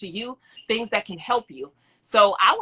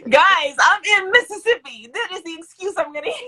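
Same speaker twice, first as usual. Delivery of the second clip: laugh-whine the excuse to the chat; playful high whine through laughter; whiny laughing excuse; 8 semitones higher, 6 dB louder than usual. Real, not acted. Excusing her bad camera, laughing.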